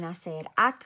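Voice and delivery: ordinary delivery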